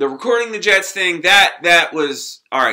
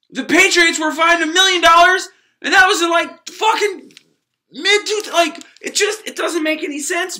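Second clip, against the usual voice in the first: higher and louder, getting heated; worked-up rant; 9 semitones higher, 3 dB louder than usual. Real, not acted. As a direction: push it up in disbelief. Ranting about the Patriots fine.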